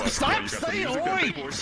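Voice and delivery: high, drawn-out wail of protest; exasperated cry. Pretend. Player begs the chanters to stop, exasperated. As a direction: stretch it into a long high plea.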